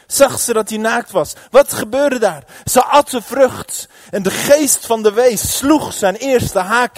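loud, high, impassioned sermon delivery; full-force preaching. Real, not acted. Preekt op volle kracht. (luid en bevlogen preken)

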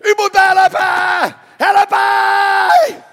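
very loud, high, drawn-out scream; screamed plea. Acted. Preacher as king screaming to God for help, acted desperate scream. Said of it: "schreeuwde uit naar God".

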